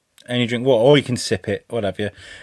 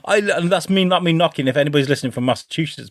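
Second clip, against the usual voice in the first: usual speaking voice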